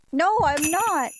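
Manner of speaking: high, slow denial; rejecting cheater accusation; insistent denial. Acted. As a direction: insist firmly. Arguing with ghost.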